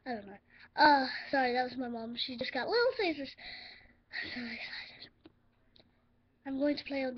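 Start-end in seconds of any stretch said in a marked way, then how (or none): 4.1-5.1 hushed unvoiced whisper; whispering so the parents won't hear. Real, not acted. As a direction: keep it secret from mom in the next room. Hiding from parents nearby.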